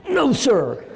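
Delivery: high, tense voice of a frightened recruit; scared young private. Retold or imitated. Frightened private answering his captain, high and stiff. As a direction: snap to attention, voice pitched high with nerves.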